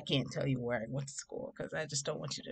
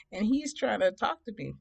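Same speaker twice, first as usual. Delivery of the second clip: ordinary delivery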